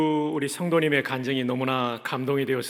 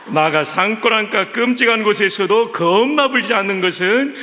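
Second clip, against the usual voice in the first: higher, louder, exuberant Jeolla dialect; 8 semitones higher, 8 dB louder than usual. Shown as a demonstration. Pastor closing the dialect readings with a higher, louder, exuberant Jeolla-dialect psalm. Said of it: "이제 마지막 전라도 버전입니다".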